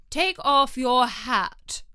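slow, loud royal shout; angry king. Acted. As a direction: bark the order like an angry king. King orders Hatter.

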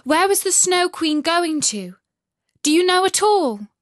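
high, loud, urgent questions; desperate to know where Kay went; eager Gerda. A narrator's reading. Gerda urgently questions the pigeons, high and loud.

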